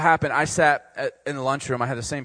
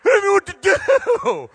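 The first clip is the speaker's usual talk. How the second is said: very high, loud, slow crybaby shout; hammed-up blubbering; mock wailing; 9 dB louder, slower than usual. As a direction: wail it like a terrified kid. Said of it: "I'm like".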